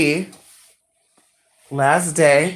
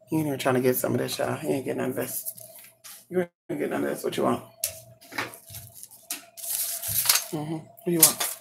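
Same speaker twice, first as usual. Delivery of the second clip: soft pet teasing; 2 semitones lower, 14 dB quieter, faster than usual. Real, not acted.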